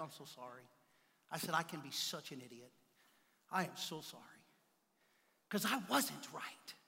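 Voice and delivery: soft, low, sincere sorry; humble apology. Retold or imitated. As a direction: apologize gently and quietly.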